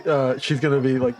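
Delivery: ordinary delivery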